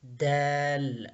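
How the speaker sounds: دال stretched slowly, long open vowel; teacher sounds out دال very slowly; drawn-out letter name. Shown as a demonstration. Teacher to pupils, slowly spelling out دال. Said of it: "اس کو ہم دال کہیں گے".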